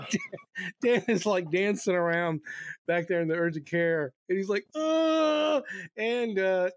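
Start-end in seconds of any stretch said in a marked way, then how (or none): 4.7-5.6 a high yelp of pain; pained cry. Retold or imitated. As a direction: yelp as you dance around hurt. Dan crying out in pain.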